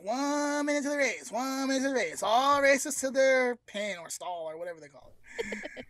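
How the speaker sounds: high, loud PA announcer; announcer call. Retold or imitated. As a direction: boom it out over the racetrack speakers. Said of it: "So the guy's like".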